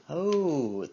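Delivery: ordinary delivery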